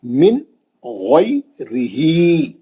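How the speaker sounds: मिन गैरिही with short n and long final ee; slow गैरिही after an unhummed मिन; izhar recitation. Shown as a demonstration. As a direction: end मिन cleanly, then slowly recite heavy गैरिही. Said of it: "इजहार है".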